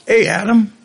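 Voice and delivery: mock holler for Adam. God calling out to hiding Adam, a mild mock holler. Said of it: "God comes down and he hollers".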